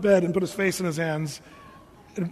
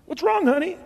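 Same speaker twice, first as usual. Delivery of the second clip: caring wife; 8 semitones higher than usual. Retold or imitated. Wife asking husband.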